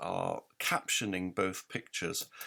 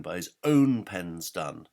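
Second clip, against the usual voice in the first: "own" given the weight, slow and louder; stress on own; 6 dB louder, slower than usual. Shown as a demonstration. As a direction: land on "own". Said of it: "put that stress on the word own".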